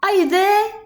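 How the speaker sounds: high, loud calling yell. A narrator's reading. Horton searching for the Whos, loud high yell. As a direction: call out into the clover.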